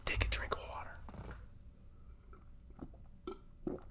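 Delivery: unvoiced whisper to oneself; whispered aside. Real, not acted. Speaker pauses mid-talk for water, whispered aside. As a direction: whisper it under your breath while regaining composure.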